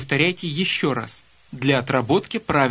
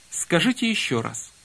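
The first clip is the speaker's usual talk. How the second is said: normal talking voice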